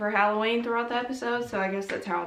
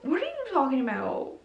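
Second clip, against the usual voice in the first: mock disbelief; 14 semitones higher than usual. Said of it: "everyone's like".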